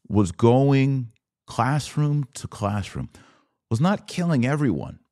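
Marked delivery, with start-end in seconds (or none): none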